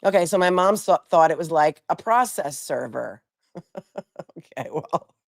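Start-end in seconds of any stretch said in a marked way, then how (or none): 3.6-4.3 soft laugh. Real, not acted. Laughing at own remark.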